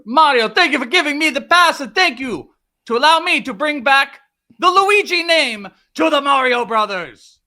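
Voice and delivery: a cartoon Italian brother, loud and proud with an Italian accent; Luigi voice. Co-host as Luigi thanks Mario in a mock Italian accent. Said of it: "speak in a very stereotypical voice just for the opening about an Italian plumber".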